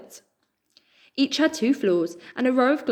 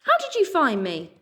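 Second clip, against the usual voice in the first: annoyed; 8 semitones higher than usual. A narrator's reading. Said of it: "scowling with annoyance".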